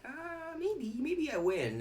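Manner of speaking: high, drawn-out wondering voice; high, hesitant mock pondering; imagined opponent. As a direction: muse slowly in a high, soft voice. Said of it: "it's like".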